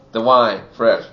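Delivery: normal talking voice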